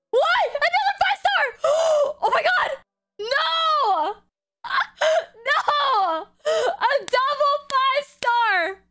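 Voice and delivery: high-pitched gasping squeals; astonished excitement. Real, not acted. Streamer getting a double five star, astonished squealing. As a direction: cry out in thrilled disbelief, voice way up.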